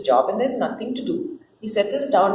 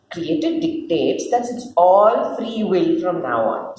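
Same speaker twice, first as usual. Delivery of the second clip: ordinary talk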